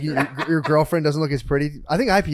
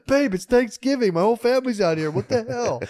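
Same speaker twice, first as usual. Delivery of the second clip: mock upset girlfriend; 7 semitones higher than usual.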